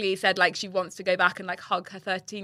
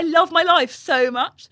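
high, loud, slow gushing; hammed-up emotional outburst; retold tearful joy; 8 semitones higher, 7 dB louder, slower than usual. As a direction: declare it as if overcome. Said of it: "like, crying".

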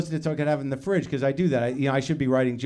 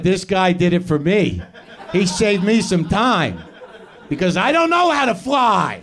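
slow, raised, drawn-out retold quote; imitating Eddie Pepitone; 3 semitones higher, 4 dB louder, slower than usual. Comic retelling Eddie's line to the audience, slow and raised. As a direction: draw out the lines slowly in a lightly put-on voice.

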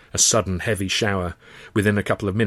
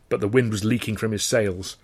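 plain reading voice

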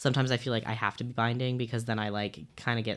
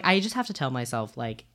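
ordinary talk, nothing marked

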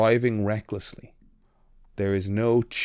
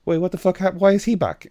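shocked; 9 semitones higher, 7 dB louder than usual. Retold or imitated. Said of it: "one of the ensigns is like".

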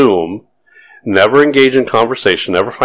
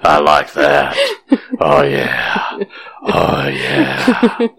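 purr voice; 8 semitones higher, slower than usual. Pretend. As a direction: purr it out like a creep. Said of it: "That's, that's my purr voice".

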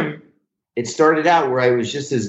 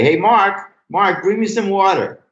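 higher-pitched call toward the wings; 9 semitones higher than usual. Retold or imitated. Comic onstage summoning his helper from the wings, calling out. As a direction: imitate a comic calling offstage for his sidekick.

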